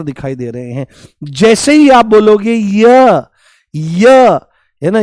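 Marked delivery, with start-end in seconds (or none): 1.2-4.1 य stretched slowly so the ई glide is heard; drawn-out य. Shown as a demonstration. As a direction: draw out य slowly and loudly. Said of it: "ध्यान से सुनने की कोशिश करो".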